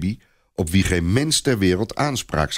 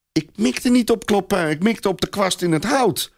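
put-on higher, child's mild shriek; mild mock scream; 12 semitones higher than usual. A narrator's reading. Little Robert defending himself, mild shriek. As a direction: cry it out in defence, pitched high. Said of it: "gilt kleine Robert".

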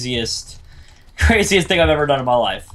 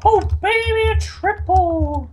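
much higher, slow drawn-out cheer; excited squeal; much slower than usual. Real, not acted. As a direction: squeal with delight. Celebrating a triple find.

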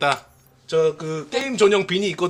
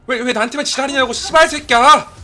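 louder mock swearing rant; pretend angry outburst; 4 semitones higher, 6 dB louder than usual. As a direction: fake a furious blow-up. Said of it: "라고 할 뻔했는데".

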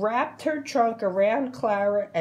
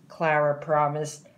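usual speaking voice

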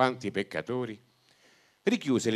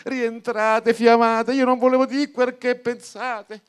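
loud, high exasperated shout; priest shouting again; 9 semitones higher, 9 dB louder than usual. Acted. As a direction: yell the correction across the church. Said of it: "il prete rigridò".

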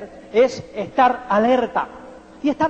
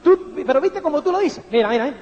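ordinary talk, nothing marked

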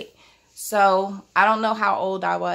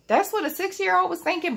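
mocking doubter; 11 semitones higher than usual. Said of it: "y'all might be saying".

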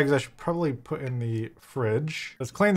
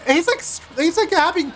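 much higher, louder disbelief; incredulous outrage; 3 dB louder than usual. Real, not acted. Gamer protesting the Reaper taking the rooster, high incredulous outrage. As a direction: squeak in indignant disbelief.

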